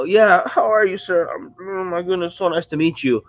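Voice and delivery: high, gushing mock greeting to a celebrity; mock fangirling. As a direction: gush over meeting him, half in jest. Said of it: "look like I'm fangirling".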